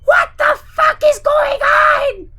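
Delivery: shocked shout. Acted. Lucy shouting at the chaos. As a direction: shout in disbelief. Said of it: "Followed quickly by".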